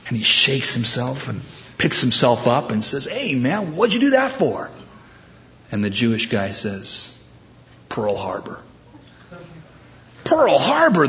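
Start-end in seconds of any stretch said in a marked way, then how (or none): 3.1-4.7 high-pitched, baffled complaint; indignant protest. Acted. Knocked-down man to his attacker in a joke, indignant. 7.9-8.6 low-key, softer deadpan reply; deadpan answer. Acted. Attacker explains his punch in a joke, deadpan. 10.2-11.1 much higher, indignant repeat; incredulous echo. Acted. Punched man echoes his attacker's excuse, incredulous.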